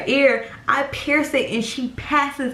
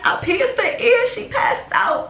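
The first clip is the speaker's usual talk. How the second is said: very high, panicked squeak; hammed-up tearful panic; trying not to cry; 12 semitones higher than usual. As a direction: reenact explaining while close to tears. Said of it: "I'm trying not to cry".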